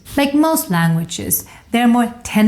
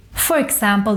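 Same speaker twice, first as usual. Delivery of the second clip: ordinary talk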